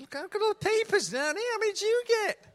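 high, loud teasing call; playful mock outcry. Preacher teasing the audience about chocolate wrappers, high mock outcry. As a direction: make a big mock fuss, voice right up high.

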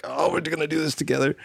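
high, quick, acted-out eagerness; eager anticipation. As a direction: recall your giddy thought without raising the volume. Retold eager thought before tour.

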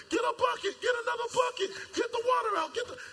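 high frantic yelling for buckets; shrill desperate crew shouting over the storm; panicked shout. Acted. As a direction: scream it like sailors in a sinking boat.

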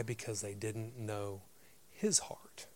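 hushed. Real, not acted. Preacher drops to emphasis.

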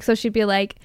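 ordinary delivery

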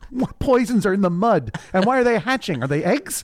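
high, indignant disbelief; rising mock bafflement at nonsense; a hammed-up confused queen; baffled outrage. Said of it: "She goes".

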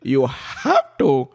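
put-on amused, thick Calabar accent. Comedian advises on being at home, thick Calabar accent. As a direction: lay the Calabar accent on for laughs. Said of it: "a very thick Calabar accent".

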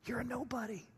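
hushed, insinuating whisper; very soft, whispered put-down. Acted. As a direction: drop to a near whisper, like a voice in someone's head. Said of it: "Maybe he heard the whisper".

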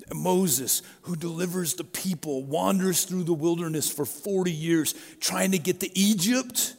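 normal talking voice

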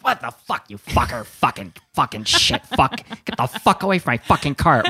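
loud, high, swearing rant with laughter breaking in; furious yelling. Retold or imitated. Homeless woman on the train screaming at a man who bumped her cart, furious yell.

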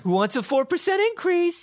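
put-on high, sing-song baby talk. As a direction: coo it like praising a toddler. Baby talk to client continues.

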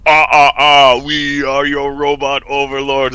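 slow, loud mock robot voice; put-on machine proclamation, drawn out; robot overlord. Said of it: "in the future, it's so like".

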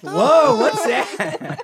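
high, loud whale-like wail; whale scream. Pretend. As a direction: let out a high wailing whale cry. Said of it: "That's the whale scream".

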